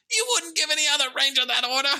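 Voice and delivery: high, squeaky, loud mock protest; impression of a whiny, squeaking son; Jaden. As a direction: squeak back at dad, hammed up. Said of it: "Just let me do the impression".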